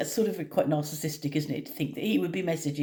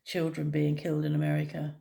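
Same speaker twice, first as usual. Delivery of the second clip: ordinary talk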